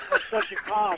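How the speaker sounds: a hammed-up high caller voice; mock caller voice. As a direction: camp it up as the caller. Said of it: "It's like".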